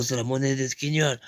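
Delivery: usual speaking voice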